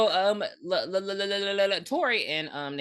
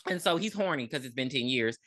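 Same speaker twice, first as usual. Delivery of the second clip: normal talking voice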